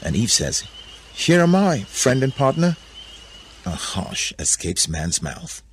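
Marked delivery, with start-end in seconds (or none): 1.1-2.8 high, projected announcement; self-presenting Eve. A narrator's reading. Eve stepping out to Adam, high projected greeting. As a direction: announce yourself high and proud.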